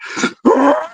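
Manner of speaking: very high wild howling cry; imitating a Bigfoot scream echoing. Shown as a demonstration. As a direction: let out a shrill creature scream. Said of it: "my Bigfoot screams".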